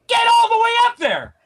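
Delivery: high, exasperated yell; mock begging outburst. Patient begging for something he shouldn't have to, exasperated yell. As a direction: cry it out in exaggerated exasperation.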